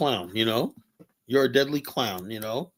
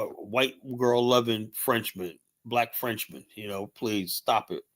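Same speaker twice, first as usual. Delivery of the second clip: ordinary delivery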